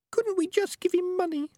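very high, tiny squeaky plea; small high creature voice; little voice. A narrator's reading. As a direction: squeak a small timid question. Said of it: "said the voice of Peaches. It was a little voice".

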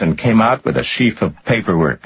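ordinary delivery

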